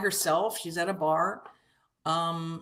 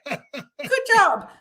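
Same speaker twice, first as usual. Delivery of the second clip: high, loud laughing repeat of "Good job"; "Good job" giggled, much higher and louder; 12 semitones higher, 9 dB louder than usual. Real, not acted.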